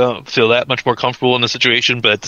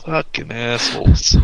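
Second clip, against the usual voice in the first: slow, resigned grumble; weary acted resentment; resigned contempt; slower than usual. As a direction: let it drag out, fed up but not angry. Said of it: "a worse resigned look".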